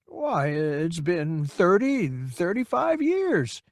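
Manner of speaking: slow, high, faltering reckoning; old man unsure of time, trailing high; wavery uncertain old man. Acted. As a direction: grope for the years. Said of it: "his eyes wavery, uncertain".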